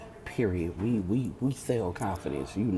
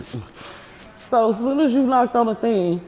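high, loud and slow, still shaken; startled loud retelling; 12 semitones higher, 13 dB louder, slower than usual. Real, not acted. Chair broke, still reacting.

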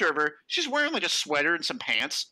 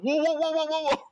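alarmed whoa; 11 semitones higher than usual. Retold or imitated. Friends reacting in alarm to a coworker's rude remark. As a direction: call out in shocked protest. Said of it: "we all started saying that".

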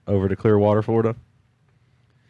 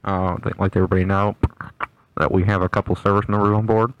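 pilot announcement; 4 dB louder than usual. Retold or imitated. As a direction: welcome the passengers like a pilot on the intercom. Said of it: "it was kinda like that".

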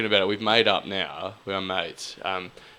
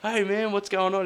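high nervous greeting; 11 semitones higher than usual. Retold or imitated. Weka's nervous reply.

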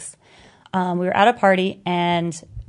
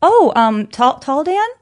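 feigned surprise; 5 semitones higher, 5 dB louder, slower than usual. Retold or imitated. Feigning ignorance about the boyfriend.

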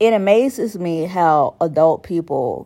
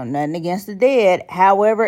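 usual speaking voice

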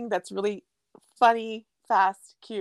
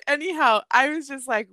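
usual speaking voice

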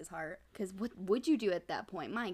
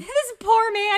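laughing; 12 semitones higher, 14 dB louder, slower than usual. Real, not acted. Laughing at co-host's note-taking.

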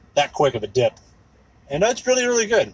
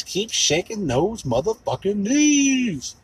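slow, drawn-out catchphrase, higher; signature sign-off; 6 semitones higher, much slower than usual. Acted. Podcaster signing off listeners with his slow catchphrase. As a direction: stretch out the sign-off slowly. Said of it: "as always".

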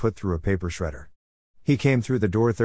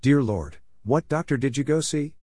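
mild yell; 5 semitones higher than usual. Retold or imitated. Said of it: "I yelled".

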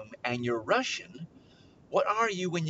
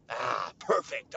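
usual speaking voice

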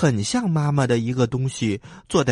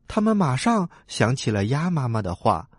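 ordinary delivery, nothing marked

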